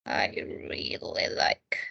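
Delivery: "I really like" in a soft whisper. Shown as a demonstration. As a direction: breathe "really" with no voice. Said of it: "whispered repeat".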